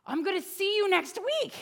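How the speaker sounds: high, eager announcement; excited, high-pitched promise; excited kid. Retold or imitated. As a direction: imitate a child thrilled about next time. Imitates excited child saying goodbye.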